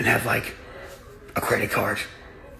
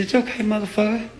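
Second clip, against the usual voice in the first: higher, taunting retold warning; mock warning; 9 semitones higher than usual. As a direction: tease him like you know what's coming. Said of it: "And I said".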